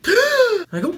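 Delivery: sharp gasp then high startled cry; shrill startled what what; panicked gasp and yelp. Retold or imitated. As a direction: jolt in fright at the brake lights. Sudden panic at brake lights.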